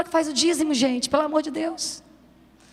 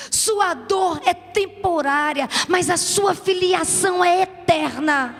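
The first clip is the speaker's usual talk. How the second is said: building sermon; 4 semitones higher, 4 dB louder, slower than usual. Acted. Rising preaching.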